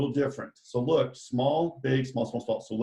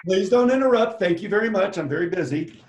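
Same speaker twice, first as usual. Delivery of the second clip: louder, higher mock scolding, settling as it goes; mock indignant; 7 semitones higher, 6 dB louder than usual. Teacher to students teasing about the French accent, mock indignant. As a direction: play at being offended by the interruption.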